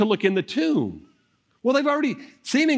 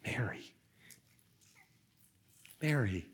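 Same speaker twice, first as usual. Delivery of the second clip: whispered, tender call of her name; a soft loving whisper, calling her name; 4 semitones lower, 13 dB quieter than usual. Acted. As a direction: breathe her name softly, full of love. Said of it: "what's the tone of voice that you hear in Jesus' voice".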